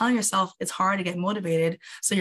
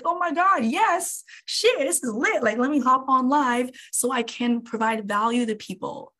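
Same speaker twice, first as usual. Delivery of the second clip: much higher, gushing with excitement; thrilled self-talk; 5 semitones higher than usual. Retold or imitated. Streamer hyping herself up to go live, much higher and thrilled. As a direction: hype yourself up about going live. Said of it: "And so I'm like".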